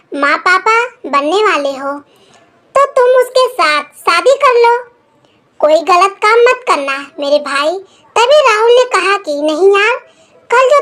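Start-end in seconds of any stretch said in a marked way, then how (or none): none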